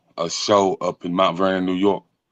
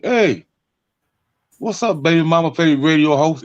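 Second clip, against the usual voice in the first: shouting a nickname at a barbecue, higher; calling out; 7 semitones higher than usual. Retold or imitated. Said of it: "people yell it out".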